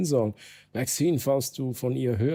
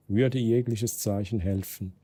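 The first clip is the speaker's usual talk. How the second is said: ordinary delivery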